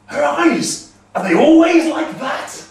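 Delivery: high, loud startled question; alarmed giant. Acted. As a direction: recoil and blurt it out. Thrym leaps back alarmed.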